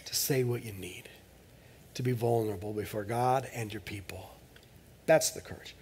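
hushed, almost a whisper. Real, not acted. Preacher to his congregation, whispering. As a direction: draw them in with a hush.

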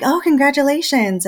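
sunny server voice; bright waitress. Retold or imitated. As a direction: mimic a cheery waitress.

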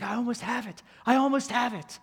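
she's greedy for the Grail, almost there; frantic reach. Retold or imitated. Woman reaching for the Grail as she slips, frantic. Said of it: "She's like".

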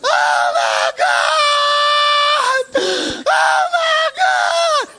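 very high, loud drawn-out scream; imitated screaming. Preacher acting out a man on his knees, imitated screaming. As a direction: wail each word long at full volume, mimicking a man overcome. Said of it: "he starts screaming".

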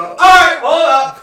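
loud, high call to stop the band; bandleader shout. Retold or imitated. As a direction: yell at the band to halt. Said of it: "I didn't do that in band and go".

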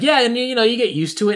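normal talking voice

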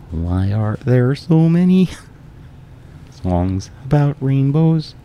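high, slow frog puppet voice; Kermit impression. Guest tries Kermit for the host. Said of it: "How about Kermit?".

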